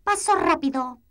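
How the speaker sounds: a little boot calling the pace. Acted. Boot giving command.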